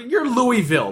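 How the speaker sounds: usual speaking voice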